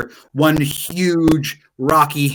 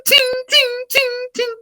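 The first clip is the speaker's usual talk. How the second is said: much higher, light "ting" taps; ping like a tiny hammer; slower than usual. Acted.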